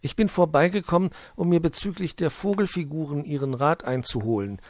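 high, loud little gnome voice; small high gnome voice; gnome Puh. Acted. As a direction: greet him as the shy gnome. Puh voice held.

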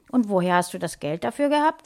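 usual speaking voice

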